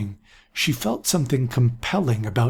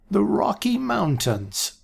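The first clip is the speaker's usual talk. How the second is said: put-on higher, slow, drawn-out old man voice; slow old seer; 9 semitones higher, slower than usual. A narrator's reading. Aaron answering the council, slow and high old voice. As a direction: let an old prophet savor the words. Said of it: "said Aaron slowly".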